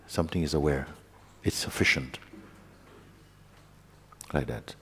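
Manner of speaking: soft, unhurried meditation guidance with long pauses; guided meditation. Acted. Teacher guiding students to rest as awareness, softly. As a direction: guide the listeners inward, quietly.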